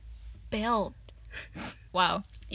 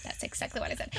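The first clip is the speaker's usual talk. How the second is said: very soft, nearly whispered aside; under the breath; 11 dB quieter, much faster than usual. Real, not acted. Guest covering a word she fumbled, hushed. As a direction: drop it to a hushed murmur.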